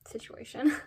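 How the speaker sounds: breathy trailing whisper. Real, not acted. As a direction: let the word fade into a whisper. End of the voiceover.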